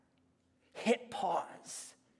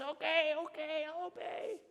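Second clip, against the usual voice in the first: higher, strained okay okay; mock pained giving in; 7 semitones higher, 4 dB quieter than usual. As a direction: squeak it out like your arm is twisted. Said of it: "the farmer says".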